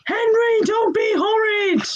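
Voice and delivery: high, whiny telling-off; whiny scolding. Acted. Peter telling Henry off, whiny. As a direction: scold like a prim little brother.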